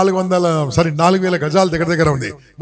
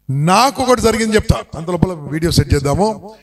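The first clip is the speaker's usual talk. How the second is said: ordinary talk, nothing marked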